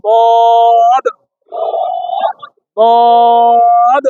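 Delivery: বদ long and firm; repeat-after-me letter; Arabic dad sound. Shown as a demonstration. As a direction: open the mouth and hold বদ. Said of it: "হা করেন, বলেন".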